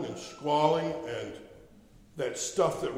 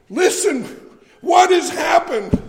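alarmed shout; 13 semitones higher, 10 dB louder, slower than usual. Retold or imitated. Asking the wailing caller what happened.

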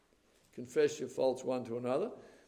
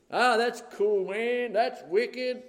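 higher, louder mock teen voice; slang talk; 6 semitones higher, 4 dB louder, slower than usual. Preacher lampooning young people's slang, high and loud. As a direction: ham up the cool-kid slang. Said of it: "in this slang talk we have".

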